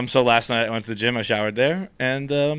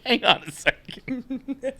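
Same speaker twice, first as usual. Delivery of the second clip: incredulous; 9 semitones higher than usual. Retold or imitated. Super learns of no running water, mock incredulous. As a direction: imitate a baffled super, pitched up.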